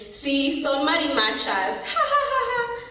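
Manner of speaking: high, laughing mock of mom; remembered mother laughing at neighbours, mocking. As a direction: imitate mom's mocking laughter. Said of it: "I heard my mom laughing".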